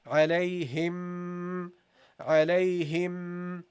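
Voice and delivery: "alayhim" very slow, long nasal m; extended ghunna. Shown as a demonstration. Teacher models the doubled meme nasal, slow. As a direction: hold the m in "alayhim". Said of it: "So you say".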